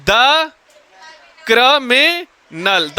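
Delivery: "criminal" very slowly, broken into cri, mi, nal; syllable by syllable. Shown as a demonstration. Teacher dictating the object to class, syllable by syllable. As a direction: draw out each syllable of "criminal" separately.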